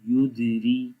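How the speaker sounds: ordinary delivery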